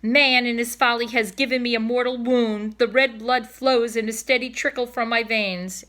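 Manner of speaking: high, melodramatic dying swan; hammed-up farewell of a wounded swan; dying old cob. Cob laments his wound.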